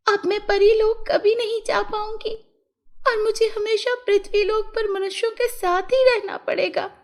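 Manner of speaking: high, plaintive fairy voice; distressed fairy. A narrator's reading. Fairy laments her stolen wings, high distressed voice.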